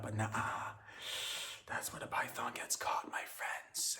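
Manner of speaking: conspiratorial whispered aside; hushed reveal. Acted. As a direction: lean in and whisper it like a secret.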